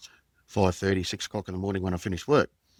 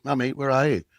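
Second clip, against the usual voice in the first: put-on a higher little-boy voice calling for mum, lightly done; imitating a small child; 5 semitones higher, 5 dB louder than usual. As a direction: lightly mimic a four-year-old looking for his mother. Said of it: "He's sort of coming in next to me".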